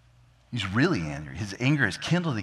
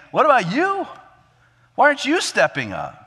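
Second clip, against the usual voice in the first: high, indignant challenge; challenging; 12 semitones higher, 5 dB louder than usual. Acted. Little brother challenging the eldest to step up, challenging. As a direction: throw the question back, pitched up high.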